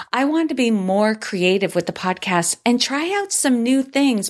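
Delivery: ordinary talk, nothing marked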